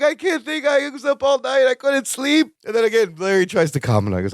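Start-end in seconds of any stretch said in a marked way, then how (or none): 0.0-2.5 panicked whining. Retold or imitated. Balki panicking, retold whining.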